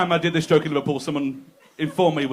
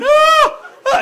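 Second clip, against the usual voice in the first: high loud mock pain screams; hammed-up agonised yelling; screaming cry; 13 dB louder than usual. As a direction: scream out like a cramp hits. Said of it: "he starts screaming".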